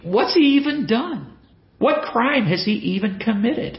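incredulous, very high-pitched questioning; Pilate. Retold or imitated. Preacher voicing the governor questioning the crowd, high incredulous protest. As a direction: voice leaping high in exasperated disbelief. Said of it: "Pilate asks again".

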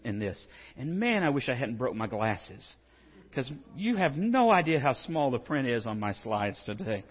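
normal talking voice

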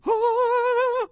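sudden high sighing "Ah" of mock piety; high mock-reverent exclamation; put-on pious voice. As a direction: gush it out in a high mock-holy falsetto. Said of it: "I don't know why I picked that voice".

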